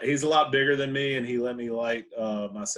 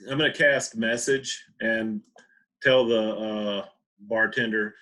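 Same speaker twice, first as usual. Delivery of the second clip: ordinary talk, nothing marked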